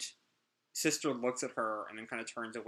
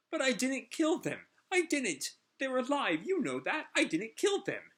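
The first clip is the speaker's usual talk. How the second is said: much higher, desperate denial; high whining plea; 13 semitones higher, 3 dB louder than usual. Acted. Villain pleads with Hermit that he killed no one, high and desperate. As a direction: whine and beg, insisting on innocence.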